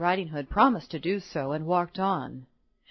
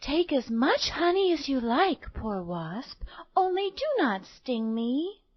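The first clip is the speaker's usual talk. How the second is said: lightly voiced sweet little girl, higher at the start; kind little girl; 5 semitones higher than usual. A narrator's reading. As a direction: gently offer it as a little girl. Girl kindly addresses a wasp.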